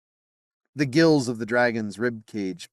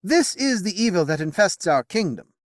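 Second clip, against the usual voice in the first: higher, louder solemn king; determined king; 10 semitones higher, 5 dB louder than usual. Acted. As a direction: declare it like a verdict. Arthur voiced in narration.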